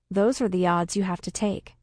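usual speaking voice

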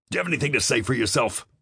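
fast, high, angry demand. A narrator's reading. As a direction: snap the question fast. Commander demanding an answer.

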